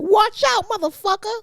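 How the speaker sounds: very high, squeaky mock yell at a passing taxi; imitating drunk friend. Mimicking her drunk friend yelling at a taxi driver, squeaky and high. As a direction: do the touchy drunk friend cussing out a cab.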